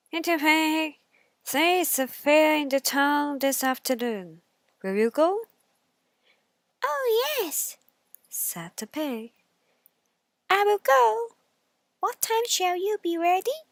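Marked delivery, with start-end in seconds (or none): 0.1-5.5 louder, higher inviting wolf; wolf with a new plan; wolf luring the pig to the fair; sly wolf. Acted. 6.8-7.8 high squeaky pig voice; eager little pig; piggy asks, much higher. Acted. Pig answers. 10.5-13.6 high squeaky pig voice; eager little pig; piggy asks, much higher. Acted. Pig answers.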